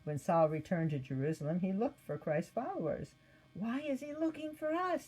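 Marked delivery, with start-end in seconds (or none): 3.5-5.1 high, nervous asking; fearful question. Acted. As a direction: ask it with suspicion and fear. Followers fearful.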